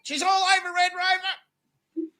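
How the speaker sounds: high, loud excited call; high thrilled exclamation. Real, not acted. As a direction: sing out the news. Excited reaction on camera.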